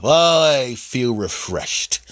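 slow, drawn-out waking sigh; slow stretch. Acted. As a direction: stretch it out like just woken.

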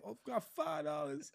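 high-pitched mock New York accent; put-on New York accent. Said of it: "I love the accent".

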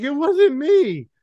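high, slow, innocent squeak; meek denial. Retold or imitated. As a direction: plead innocence in a tiny voice. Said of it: "And I was just like".

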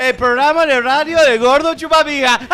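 high, loud mocking announcer voice; mock announcer shout. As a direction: belt it out like a mock jingle. Mocking the catchphrase loudly.

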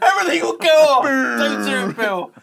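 shouting plea. Real, not acted. Pleading about the lights.

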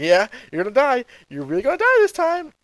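mock crazed villain, pitch climbing high; crazed Frieza. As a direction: gloat like a madman who is cracking up. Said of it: "He starts getting crazy".

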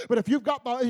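ordinary talk

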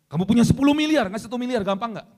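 very loud, high quiz shout; peak challenge. Pretend. Preacher challenges congregation with the biggest sum, loud high shout. Challenge peaks at ten billion.

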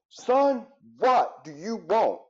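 sharp, high, irritated snap at his son; snapping angrily. Retold or imitated. Father snapping at his little son in the store, irritated. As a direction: bark it out, fed up. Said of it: "And I just flipped".

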